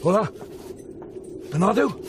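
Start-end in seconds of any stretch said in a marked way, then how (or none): 1.5-2.0 raised, questioning call. Acted. Sentry seeking his fellow Bernardo, calling out. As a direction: call a name into the dark.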